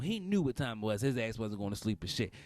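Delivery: ordinary talk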